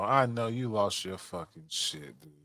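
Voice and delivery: usual speaking voice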